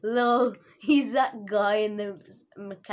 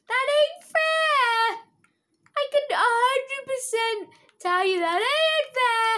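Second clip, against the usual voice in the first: whiny complaint; 6 dB louder than usual.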